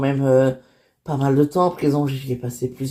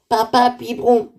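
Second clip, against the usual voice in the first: lightly voiced toddler asking for his bottle; a small child pleading to his dad for a bottle; little boy voice; 7 semitones higher than usual. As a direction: petit enfant qui réclame. Toddler asks again for bottle.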